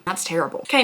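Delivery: ordinary talk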